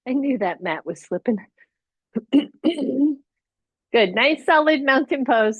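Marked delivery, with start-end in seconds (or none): 2.3-4.1 clearing throat. Real, not acted. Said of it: "Sorry for all the throat clearing".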